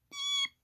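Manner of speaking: tiny, very high soft peep; chick peep. A narrator's reading. Chick follows its mother, tiny high peep. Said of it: "her chick said".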